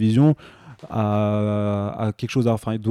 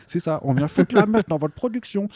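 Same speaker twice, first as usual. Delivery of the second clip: high, loud mocking impersonation; high mock creatives voice; 8 semitones higher, 5 dB louder than usual. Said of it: "ils ont fait".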